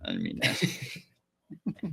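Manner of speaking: slow breathy half-whisper; sheepish whisper. Retold or imitated. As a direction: take it back in a slow whisper.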